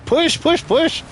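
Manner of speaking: high, pleading push push push; excited urging; urgent cheering. Real, not acted.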